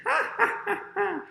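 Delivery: high staged cackle; high, falling ha-ha laugh; fox's gloating laugh. Acted. Missus Fox gets the eggs, high staged laugh. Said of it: "goes with the eggs and says".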